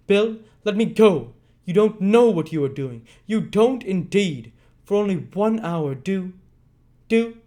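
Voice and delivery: earnest pleading. Acted. Nancy begging Bill from the floor, earnest. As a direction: beg him from the floor. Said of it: "with great earnestness".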